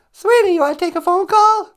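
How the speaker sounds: high loud squeaky wife. Acted. Wife offering her husband a phone call, squeaky. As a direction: chirp it like the wife.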